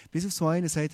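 ordinary talk, nothing marked

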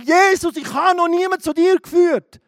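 preacher reliving his loud prayer to Jesus; loud pleading prayer. Retold or imitated. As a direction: pray it out loud, desperate. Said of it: "Ich habe im, im Mai angefangen".

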